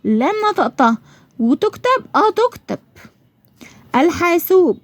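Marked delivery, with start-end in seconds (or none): 4.0-4.8 الحاسوب with the L clearly sounded before h; higher, deliberate الحاسوب, every sound distinct; pronounced lam. Shown as a demonstration. Teacher showing pupils the lam is said, clear L in الحاسوب. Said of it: "نطقتها اهو وكتبتها".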